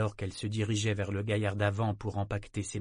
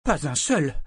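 loud, high indignant denial; furious; 5 semitones higher, 6 dB louder than usual. A narrator's reading. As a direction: fire back furiously. (réplique furieuse)

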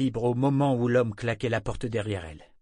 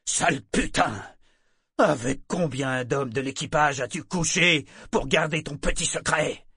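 loud, raised-pitch angry tirade; furious threats; 6 semitones higher, 6 dB louder than usual. A narrator's reading. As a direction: threaten and insult at full voice. Furious captain throws her out. (tirade furieuse, voix forte)

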